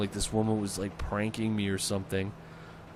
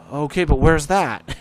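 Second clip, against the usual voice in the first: louder and slow, the same baffled question; mock dispatcher, louder; 5 semitones higher, 11 dB louder, slower than usual. Dispatcher asks a third time, louder and slow. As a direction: drag it out, slow and clueless.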